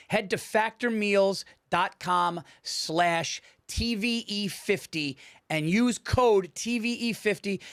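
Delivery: slow, clear ad read of the promo code. Acted. Host reading the sponsor code to listeners, slow and clear.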